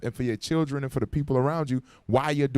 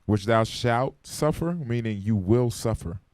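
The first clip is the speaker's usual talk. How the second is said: slow, weighty stress on "Which thou shalt suffer"; emphasized; slower than usual. Shown as a demonstration. Teacher to class, stressing the verse slowly. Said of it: "I'm emphasizing those words".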